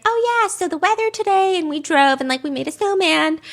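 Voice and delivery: high, chirpy small-talk mom voice; breezy, cheerful mom chatting about the weather; lightly hammed-up cheerful mom; imitating his mom. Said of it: "his mom being like".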